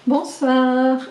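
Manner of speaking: ordinary talk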